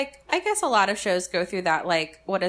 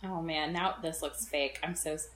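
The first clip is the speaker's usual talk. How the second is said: embarrassed mumble; 3 semitones lower, 8 dB quieter than usual. Real, not acted. Embarrassed by a review sounding fake.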